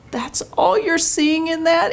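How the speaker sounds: pitched high, stunned question; can't believe his take on it; incredulous. Retold or imitated. Questioning her pastor's view of the ad, high incredulous.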